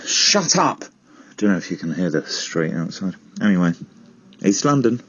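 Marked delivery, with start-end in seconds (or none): 0.1-0.8 higher-pitched snap at the noise; irritated yell. Acted. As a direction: call out crossly to the street. Yells at noise in street.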